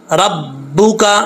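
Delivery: ordinary delivery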